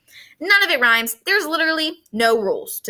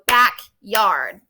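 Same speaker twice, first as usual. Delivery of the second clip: "backyard" slowed into two clear beats, back-yard; clapped syllables; 4 semitones lower than usual. Shown as a demonstration. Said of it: "two syllables".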